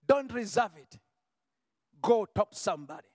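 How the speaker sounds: usual speaking voice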